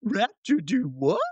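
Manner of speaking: high, slow, put-on caveman "Raptor do what?"; hammed-up dim dinosaur; dumb raptor voice. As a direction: play the raptor as a baffled brute.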